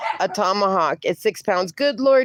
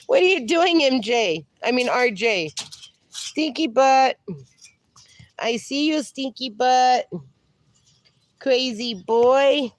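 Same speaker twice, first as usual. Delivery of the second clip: pet talk; 3 semitones higher than usual. Real, not acted. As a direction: croon the nicknames at the dog. Cooing at dog, slow endearments.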